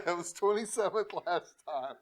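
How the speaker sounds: laughing through the words; amused, still cracking up. Real, not acted. Repeats correction, cracking up.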